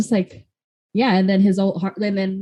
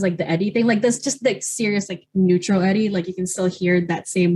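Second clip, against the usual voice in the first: usual speaking voice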